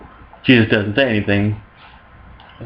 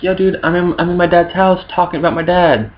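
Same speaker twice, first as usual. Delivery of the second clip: put-on teen Jesus whine; 6 semitones higher than usual. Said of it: "Jesus is all like".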